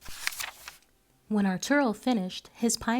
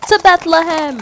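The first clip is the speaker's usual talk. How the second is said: high, loud playful cry; boy playing with a toy donkey; playful shout; 8 semitones higher, 16 dB louder than usual. A narrator's reading. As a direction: shout like leading a race. Boy racing wooden donkey.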